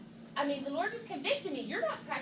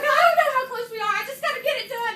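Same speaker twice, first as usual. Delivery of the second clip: higher and louder, mock frazzled; frantic reply; 10 semitones higher, 12 dB louder than usual. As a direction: act out the rushed texting panic. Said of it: "I'm like".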